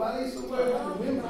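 normal talking voice

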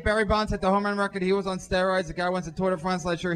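usual speaking voice

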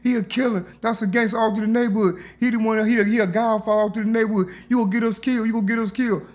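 high, panicky retold warning; scared friends. Frightened friends telling him he'll get them killed, high and panicky. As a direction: plead with him, rattled and high. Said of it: "them all scared".

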